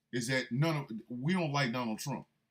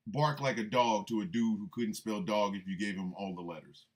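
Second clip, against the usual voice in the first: ordinary delivery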